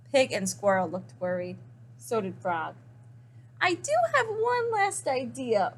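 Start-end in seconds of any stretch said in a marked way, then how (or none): 3.6-5.7 high, oinky pig voice; oinking pig. Acted. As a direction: say it like a piggy oink. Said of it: "oinked Pig".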